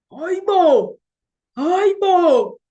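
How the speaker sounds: high, loud outcry of disbelief; shocked cry. Real, not acted. Vlogger crying out in disbelief at the news. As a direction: exclaim it in shock.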